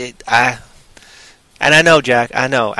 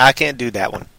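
ordinary talk, nothing marked

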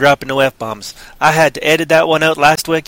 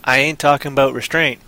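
ordinary delivery

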